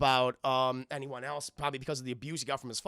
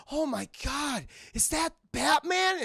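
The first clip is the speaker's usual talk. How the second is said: high, drawn-out mock amazement; awestruck kids; 10 semitones higher, 4 dB louder, slower than usual. Kids spotting Batman on the wall, awestruck. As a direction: voice the kids gasping in wonder.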